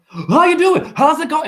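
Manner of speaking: high, loud peppy greeting; mimicking a hyper customer at checkout. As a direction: chirp it like an over-eager shopper.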